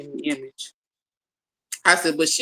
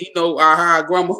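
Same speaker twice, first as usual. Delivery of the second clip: ordinary talk, nothing marked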